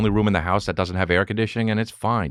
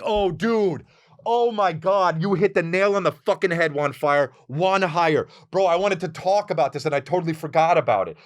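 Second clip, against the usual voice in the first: excited outburst; 11 semitones higher than usual. Real, not acted. Excited about a listener's comment.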